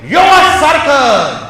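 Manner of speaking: high, loud preacher's shout; ringing, high-pitched sermon delivery; full-force preaching. Real, not acted. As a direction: proclaim it forcefully to a congregation.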